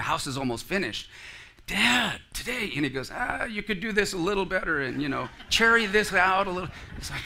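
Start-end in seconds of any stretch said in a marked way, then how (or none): 1.7-2.7 high-pitched voice of a son asking Dad; eager son. Acted. Son asks his father if it's today, eager. 3.1-6.7 higher-pitched, hedging dad; a hint of a father finding fault; lightly voiced fussy father. Father puts off his son, lightly voiced fussing.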